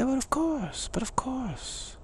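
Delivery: high, airy aristocrat voice; aristocracy. Acted. Aristocrat agreeing the day is lovely, airily assured. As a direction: draw out the last words, airily assured. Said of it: "the aristocracy says".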